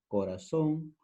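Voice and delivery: "corazón" with the z said as a plain s; seseo. Shown as a demonstration. Teacher showing students Peruvian seseo: "corazón" with z as s. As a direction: say "corazón" as coraSón, z like s. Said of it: "suena igual como si fuera con la S".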